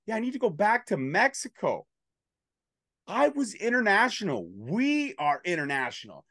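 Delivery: lightly voiced Alex Kane bragging; imitating Alex Kane. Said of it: "Alex says".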